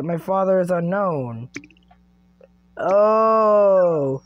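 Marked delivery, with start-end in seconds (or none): 2.8-4.2 loud, drawn-out oh of shock; a big stretched gasp of an oh; surprised oh. Real, not acted. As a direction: let the oh hang in dismay. Father unknown reaction.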